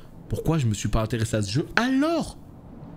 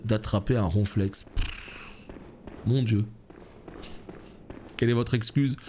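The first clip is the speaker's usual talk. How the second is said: normal talking voice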